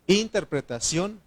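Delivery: ordinary talk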